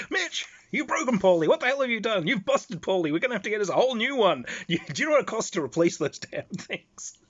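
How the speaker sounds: high, loud mock scolding; mock yelling. Bruce scolding Mitch over the laughing friend, mock outrage. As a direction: chew him out in jest, hammed up. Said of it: "Bruce starts yelling at Mitch".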